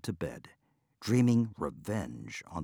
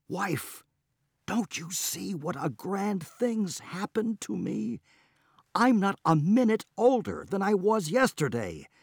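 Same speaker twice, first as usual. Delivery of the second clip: gleeful; 8 semitones higher than usual. Acted. Gleeful husband rejoicing.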